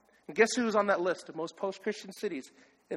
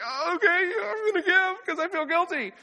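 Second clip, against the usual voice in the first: much higher, put-on voice of a reluctant giver; mock guilty giver; 9 semitones higher than usual. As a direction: caricature someone caving in out of guilt. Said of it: "Don't be that emotional giver that was like".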